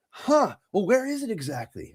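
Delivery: high hammed-up asking; mock healer question. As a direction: play the curious healer, high. Acupuncturist asks.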